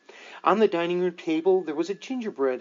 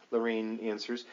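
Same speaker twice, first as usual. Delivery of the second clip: ordinary delivery, nothing marked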